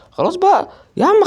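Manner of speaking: very high, loud fed-up cry; exasperated shout. Real, not acted. Gamer fed up with the opponent, exasperated shout. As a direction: snap it out in frustration.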